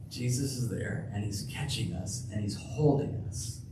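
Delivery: soft tender assurance; tender reassurance. Real, not acted. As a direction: comfort them gently.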